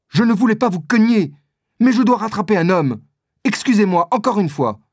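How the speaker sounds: voix de jeune homme plus aiguë, pressée; s'excuser vite, pressé de repartir; jeune D'Artagnan pressé. A narrator's reading. D'Artagnan voiced by narrator. (D'Artagnan pressé s'excuse auprès d'Athos, voix plus aiguë)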